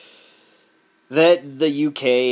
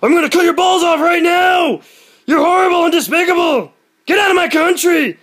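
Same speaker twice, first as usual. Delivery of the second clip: much higher and louder mock shouting; hammed-up rage; 12 dB louder than usual. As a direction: scream it like a cartoon hothead. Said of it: "the conservative gun nut would be like".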